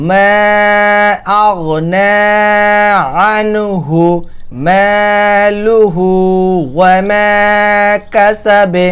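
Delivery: slow chanted verse with long মালুহু; recitation demo. Shown as a demonstration. Teacher demonstrating heavy গয়ন in recitation. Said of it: "আবার বলুন".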